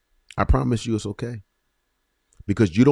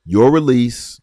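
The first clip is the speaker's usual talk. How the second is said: loud, raised cry of Your release; preaching swell; 5 semitones higher, 9 dB louder, slower than usual. Real, not acted. Preacher to listeners, loud proclaiming swell. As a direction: lift it out like a proclamation.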